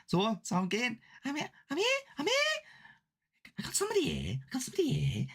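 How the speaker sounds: high-pitched comic psychic, accent sliding into Welsh; put-on lilting northern medium, sensing a presence; Clinton Baptiste. Shown as a demonstration. Streamer plays a comedy medium for viewers, high silly impression. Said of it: "I might be able to do Clinton Baptiste".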